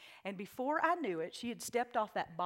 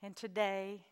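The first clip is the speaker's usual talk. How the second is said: closing prayer. Acted. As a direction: speak gently over the music.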